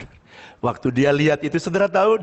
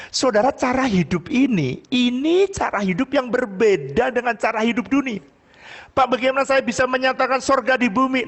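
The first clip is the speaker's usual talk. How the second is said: raised, high-pitched sermon voice; impassioned preaching; 12 semitones higher than usual. Real, not acted. Pastor urges congregation toward a different way of life, impassioned preaching. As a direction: drive the message home to the congregation.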